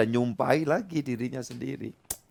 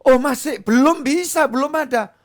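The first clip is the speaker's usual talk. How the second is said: discouraging parent; 12 semitones higher, 6 dB louder than usual. Acted.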